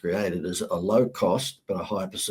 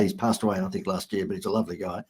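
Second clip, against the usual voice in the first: ordinary delivery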